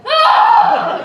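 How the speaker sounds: very high, loud shriek; terrified answering scream. Retold or imitated. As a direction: shriek back in shock.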